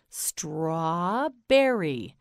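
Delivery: "Strawberry" very slow, long straw, then berry; syllables stretched out. Shown as a demonstration. Teacher models for learner, "Strawberry" slowly pulled apart. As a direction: draw out "Strawberry" in separate parts. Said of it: "You say it".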